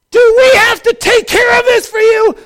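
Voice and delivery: furious high shout as Moses; mad. Retold or imitated. Moses to the whining Israelites, mad shouting. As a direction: yell it, utterly fed up. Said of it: "He was mad".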